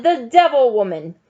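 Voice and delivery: loud, high-pitched cry of denunciation; accusing shout. A narrator's reading. Mob leader denouncing the teacher before the mob, shouting. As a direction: denounce her at full voice. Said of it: "Trout shouted".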